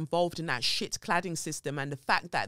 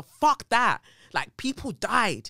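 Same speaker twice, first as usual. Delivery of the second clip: louder and higher, people died hammered home; angry outburst; 6 semitones higher, 6 dB louder than usual. Real, not acted. Rejecting spiritual excuses for the Grenfell deaths, angry and loud. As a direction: snap with real anger.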